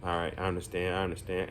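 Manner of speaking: low, soft, subdued imitation; retold nervous agreement. Nervous kid agreeing with his counselor, quietly subdued. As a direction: meekly give in, as the nervous kid would. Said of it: "he's still just kinda nervous".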